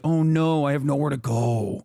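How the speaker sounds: mock wail, dropping low; mocking imitation. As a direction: whine it out as a helpless fan, hammed-up. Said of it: "And then you're like".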